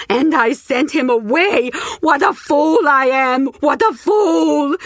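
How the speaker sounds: high, loud, slow anguished wail; moaning in pain. Acted. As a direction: wail at your own foolishness. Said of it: "moaned in genuine pain".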